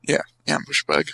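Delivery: deep, droning mock agreement; low mock. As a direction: drop the voice way down.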